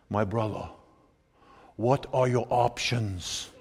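put-on calm, slow French-accented reply to a student. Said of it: "real thick, you know, French accent".

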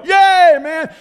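high, loud cheer from the stands; mock cheer. Kids in the stands cheering on Craig, loud cheer. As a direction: whoop it up like a bleacher crowd.

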